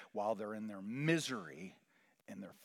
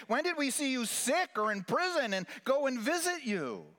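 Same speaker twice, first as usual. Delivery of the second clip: rising, louder and higher plea; pleading questions; 9 semitones higher, 5 dB louder than usual. Acted. Preacher voicing the righteous, rising loud plea. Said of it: "the righteous will answer".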